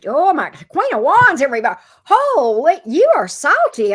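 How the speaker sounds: loud and high with excitement; excited outburst. Real, not acted. Reacting to angry Queen cards.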